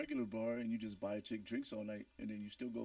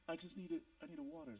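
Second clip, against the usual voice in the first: put-on small child; 14 semitones higher than usual. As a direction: play a pleading little kid. Voicing the kid sneaking out.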